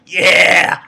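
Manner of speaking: loud, pumped-up "yeah"; excited cheer. Retold or imitated. Fan reliving his reaction to the chapter, excited cheer. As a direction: cheer it out, thrilled by the moment.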